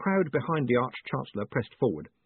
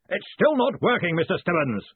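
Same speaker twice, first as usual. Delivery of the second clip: high, loud bellow; big blustering shout; 8 semitones higher, 3 dB louder than usual. A narrator's reading. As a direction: bellow it in annoyance across the room. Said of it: "he bellowed".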